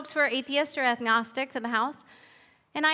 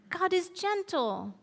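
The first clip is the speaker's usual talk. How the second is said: higher and softer, birdlike; the robin answers with a soft chirp; 5 semitones higher, 4 dB quieter than usual. Acted. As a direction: chirp it sweetly like a little robin. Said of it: "chirped the robin".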